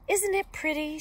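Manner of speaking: put-on a vain girl, voice lifted a little; lightly voiced Little Red. A narrator's reading. Little Red showing off her cape to the wolf, lightly voiced vain girl.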